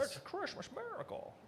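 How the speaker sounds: wide-eyed classic film hero; Jimmy Stewart impression. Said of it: "That was my Jimmy Stewart right there".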